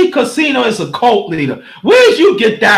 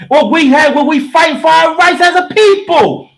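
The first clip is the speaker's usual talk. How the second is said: ordinary talk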